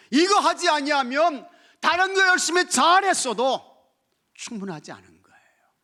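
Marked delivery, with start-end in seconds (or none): 0.1-3.6 loud, high preaching cry; shouting the gospel from the pulpit; fiery preaching. Acted. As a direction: proclaim it with full force. Preacher at full force.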